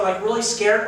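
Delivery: ordinary talk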